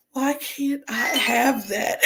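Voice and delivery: mild mock longing, slow; wistful plea. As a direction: ask it slowly like you're aching for it. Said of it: "everybody else's story was like".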